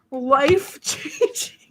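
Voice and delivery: slow laughing gush over a product; drawn-out, laughing Life-changing; gushing beauty guru. Pretend.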